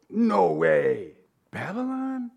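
mock-shocked, leaping up high on the question; acted disbelief. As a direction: gape at an unbelievable headline. Acting out reading shocking headline.

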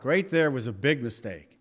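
ordinary talk, nothing marked

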